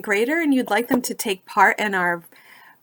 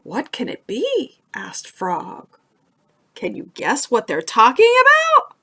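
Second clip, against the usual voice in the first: lively storybook narration, rising higher as the story heats up; reading to children; 5 semitones higher, 4 dB louder than usual. A narrator's reading. Librarian reading Frog and Toad to young listeners, animated storybook voice. Reads picture book to children.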